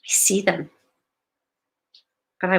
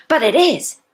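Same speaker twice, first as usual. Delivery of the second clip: higher, louder imitated objection; mock protest; 6 semitones higher, 6 dB louder than usual. Coach voicing a client who argues back, mock protesting. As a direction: play a client insisting back. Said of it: "Then you may go".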